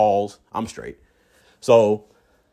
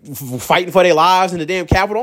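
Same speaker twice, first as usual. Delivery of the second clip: normal talking voice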